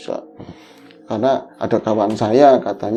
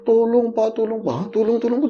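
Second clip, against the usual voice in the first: high-pitched retold plea; imitated desperate begging, pitched up; pleading for help; 8 semitones higher than usual. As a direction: act out a cry for help without much volume. Acting drowning child's plea for help.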